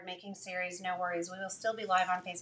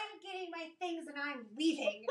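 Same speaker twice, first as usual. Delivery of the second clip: mock dramatic exit; 8 semitones higher, slower than usual. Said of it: "I'm like".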